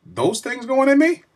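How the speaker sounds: alarmed. Retold or imitated. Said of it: "I said, Yo".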